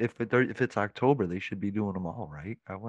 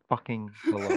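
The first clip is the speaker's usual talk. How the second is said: mock Kentucky hello. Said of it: "It's a Kentucky hello".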